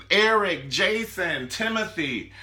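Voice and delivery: high, loud list of lovers' names; mock passionate calling. Son saying anything but a female's name, mock passionate calling. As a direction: call each name out, hammed-up.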